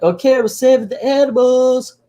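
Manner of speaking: high-pitched mock kiddie voice; obedient kids. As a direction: chirp back like a cartoon child. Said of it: "And they're like".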